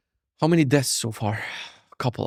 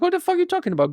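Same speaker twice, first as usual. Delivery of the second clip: mock bafflement; much faster than usual. Mocking himself confused at mechanic.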